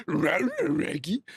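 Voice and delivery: garbled talking-dog catchphrase; Scooby-Doo. Acted. Streamer showing all he can do, cartoon dog voice. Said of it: "all I can do as Scooby".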